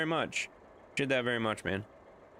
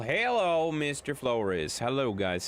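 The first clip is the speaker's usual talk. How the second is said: ordinary talk, nothing marked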